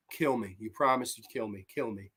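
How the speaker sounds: soft, drained retold plea; understated, hushed acting of a broken woman; mock weak begging. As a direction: beg quietly, voice sinking away. Acts broken woman begging for death.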